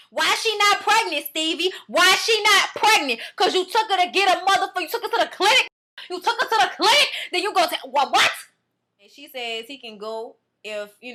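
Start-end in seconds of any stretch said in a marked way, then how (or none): 0.1-8.3 high, loud accusing questions; angry confrontation. Retold or imitated. Acts out confronting Stevie over pregnancy.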